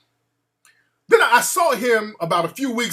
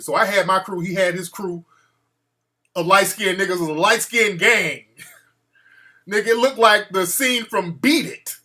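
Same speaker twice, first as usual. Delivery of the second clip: normal talking voice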